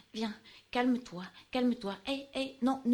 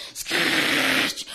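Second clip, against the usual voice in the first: a loud, rough, toneless call of scratch; loud hissed cry; 7 semitones lower, 8 dB louder than usual. Acted. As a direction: hiss the word out in alarm.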